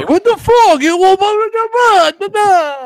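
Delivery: high, loud mock rude celebrity. Host mocking a rude celebrity at a signing table. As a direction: ham up a snotty star brushing off fans. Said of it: "I will be that same guy".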